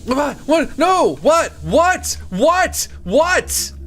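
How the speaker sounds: high, loud, rapid-fire shouts of alarm; startled yelling. Real, not acted. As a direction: yelp each word separately in real shock. Sudden startle in the game.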